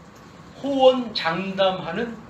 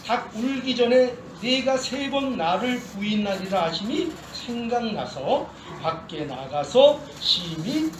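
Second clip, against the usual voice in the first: measured, solemn Bible reading; scripture reading; 3 semitones lower, 4 dB quieter than usual. Acted. Pastor reading Scripture to his church, solemn and measured. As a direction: read the passage to the congregation.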